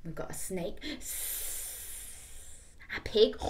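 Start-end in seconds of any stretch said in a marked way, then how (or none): 0.0-0.8 hushed snaky whisper. Real, not acted. Reader whispers snake to child. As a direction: hiss it softly.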